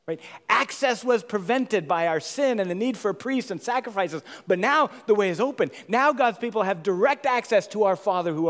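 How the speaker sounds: loud, high, driving sermon; preaching at full force. Acted. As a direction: build the proclamation with fire. Preaching the open temple.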